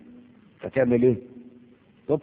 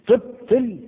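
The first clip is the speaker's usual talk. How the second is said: تبطل thrown out much higher and louder; emphatic stress; 13 semitones higher, 8 dB louder, much slower than usual. Shown as a demonstration. Teacher hammering the key word to students, loud and high. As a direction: hit تبطل hard and high to drive it home.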